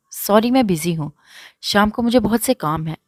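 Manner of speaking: ordinary delivery, nothing marked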